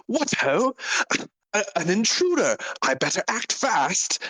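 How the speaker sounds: high-pitched theatrical alarm; stagey, high exclamation; alarmed gallant pirate. Acted. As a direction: cry out like a melodramatic hero spotting trouble. Pirate spots an intruder.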